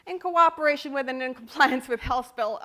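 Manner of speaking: loud, monotone official jargon; mock bureaucratic announcement; stiff bureaucratic read. Shown as a demonstration. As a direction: drone it like a committee memo. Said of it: "some people write like this".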